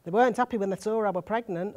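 usual speaking voice

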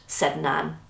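usual speaking voice

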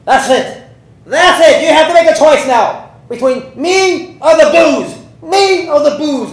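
high, shouted Irish ultimatum; Irish accent. Retold or imitated. Irish wife demands her drunk husband choose, shouting in Irish accent. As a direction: yell it in a hammed-up Irish accent. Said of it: "this is my best Irish accent".

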